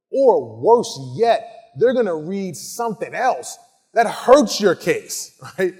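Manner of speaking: ordinary talk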